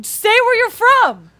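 high, loud mock demand; exasperated friend. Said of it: "why don't I be Dee".

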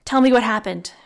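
loud, firm demand. A narrator's reading. Connor demanding answers from Ree, forceful. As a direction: press hard for an answer. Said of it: "Connor demanded".

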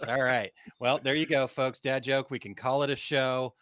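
ordinary delivery

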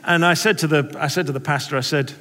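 ordinary talk, nothing marked

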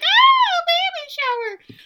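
very high, loud mock squeal; excited squeal. Recapper imitates girls thrilled about a baby shower, excited squeal. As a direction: imitate a gaggle of girls shrieking, drawn out.

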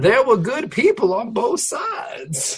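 put-on a president's lines drawled in a folksy Mark Twain accent; Donald Trump. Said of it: "doing all of his press conferences in that voice".

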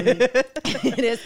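laughing through the words. Real, not acted. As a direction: giggle as you say it. Laughing at own joke.

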